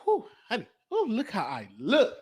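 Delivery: ordinary talk, nothing marked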